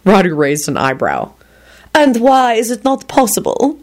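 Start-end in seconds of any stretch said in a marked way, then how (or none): 1.9-3.8 higher, coolly challenging question. A narrator's reading. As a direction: challenge him with mild coolness. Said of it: "responded coolly".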